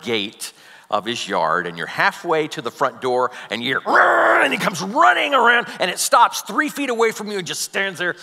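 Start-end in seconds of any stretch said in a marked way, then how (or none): 3.8-4.4 dog growl. Retold or imitated. Imitating the pit bull's growl.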